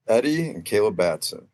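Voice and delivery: ordinary delivery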